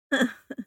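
high cold laugh bursts; chilling laugh. A narrator's reading. Dark lord mocking the kneeling man, chilling laugh. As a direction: laugh to freeze the blood. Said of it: "éclats de rire à glacer le sang".

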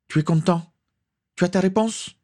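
high-pitched impatient questioning. A narrator's reading. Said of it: "s'impatienta Gorluna".

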